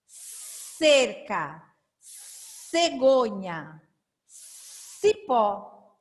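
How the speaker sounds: each word from "Cebola" to "cinco" opened with a long hissed "s"; slow word list, stretching every initial "s"; prolonged initial S. Shown as a demonstration. Teacher showing the difference in sound, drawn-out "s". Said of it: "perceber a diferença do som".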